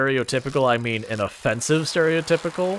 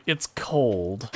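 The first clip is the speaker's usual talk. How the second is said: mimicking sister; 4 semitones lower, 4 dB quieter than usual. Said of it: "her whole thing is, like".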